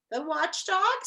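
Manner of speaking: puzzled rising question on watchdog; quizzical. A narrator's reading. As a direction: ask it, curious and puzzled. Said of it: "said Milo quizzically".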